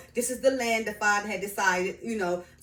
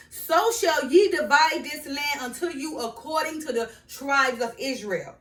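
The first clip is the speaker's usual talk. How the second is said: higher, louder verse proclamation; scripture reading; 5 semitones higher, 6 dB louder than usual. Acted. Reading the dividing of land to viewers, scripture reading. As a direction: deliver the command emphatically, as from scripture.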